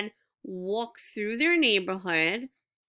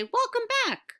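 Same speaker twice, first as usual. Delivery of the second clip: much higher, excited welcome for an exclamation mark. Shown as a demonstration. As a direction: voice jumps way up high, bright and eager. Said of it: "I'd raise my voice".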